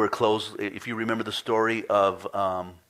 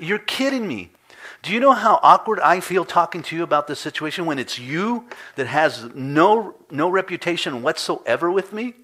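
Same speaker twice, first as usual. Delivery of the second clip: higher, louder exasperated rant; frustrated retort; 8 semitones higher, 8 dB louder than usual. Retold or imitated. Customer venting at the rental manager, frustrated and louder. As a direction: scold him in disbelief. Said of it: "I just got a little frustrated".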